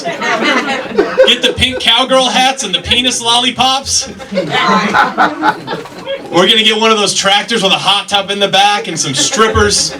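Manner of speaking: a widow gleefully planning strippers; high laughing bachelorette voice; giddy party planner. Retold or imitated. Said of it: "she's like".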